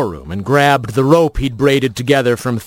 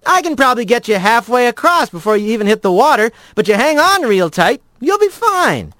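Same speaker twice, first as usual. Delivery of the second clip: higher, quick and breezy; a rat brushing off worries; 9 semitones higher than usual. A narrator's reading. Rat reassures lizard.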